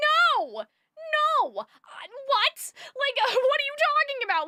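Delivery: disbelieving, voice jumping up; incredulous. Retold or imitated. Exasperated reply to commenter.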